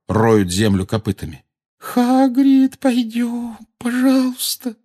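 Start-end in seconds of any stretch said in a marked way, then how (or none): 1.8-4.8 very high, pleading call; high frightened voice. A narrator's reading. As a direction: plead fearfully to come away. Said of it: "сказала Гермиона высоким испуганным голосом". (высоким испуганным голосом, умоляя)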